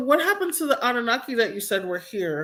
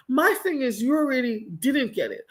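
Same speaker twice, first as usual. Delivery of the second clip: ordinary talk, nothing marked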